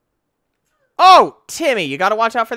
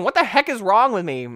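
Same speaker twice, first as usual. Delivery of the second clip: ordinary talk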